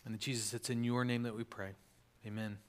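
low, soft, reverent praying voice; soft, low congregational prayer to close; hushed prayer. Real, not acted. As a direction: pray quietly, pitched well below talking voice. Closing prayer before the talk.

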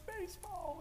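mock dad cheer. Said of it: "just, like".

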